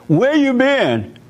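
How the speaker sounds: sharp, high, slow demand from a suspicious wife; angry mother. Retold or imitated. Wife confronting husband who came home late, sharp and high.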